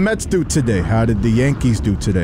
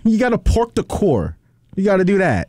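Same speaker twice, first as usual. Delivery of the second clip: put-on Boston accent; 5 semitones higher than usual. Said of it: "the Bostonian accent".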